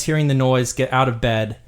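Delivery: ordinary delivery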